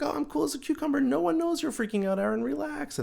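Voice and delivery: high, lightly voiced fake calm to himself; mock calm. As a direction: pretend to be cool as a cucumber. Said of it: "on the outside, I'm thinking".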